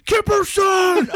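high, slow shout; shouted name. Retold or imitated. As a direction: yell it out long. Yelled name.

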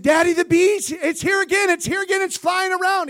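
put-on very high, loud child-like shriek; panicked screaming. Little girl telling her dad the bee is back, screaming. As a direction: scream in rising panic for Daddy. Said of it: "Screaming, screaming and tear, tears streaming down her face".

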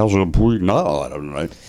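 mumbled sportscaster sinking low; Ross Porter. Acted. Repeating the new player in the drunk announcer bit, mumbled impression. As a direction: let the catchphrase tumble down low. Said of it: "a Ross Porter impression".

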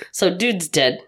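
ordinary talk

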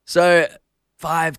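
ordinary delivery

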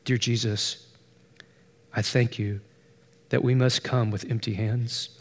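soft, low, hushed thanks; hushed prayer. A narrator's reading. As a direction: pray it quietly, tears still falling. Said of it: "she whispered".

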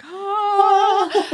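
high sung choir note; angel choir "ah". Retold or imitated. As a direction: hold a heavenly "ah" like angels. Angelic choir sound effect.

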